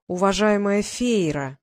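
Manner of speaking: slow, each syllable stretched mockingly; drawn-out syllables. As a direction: drag out every syllable on purpose. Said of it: "намеренно растягивая слоги".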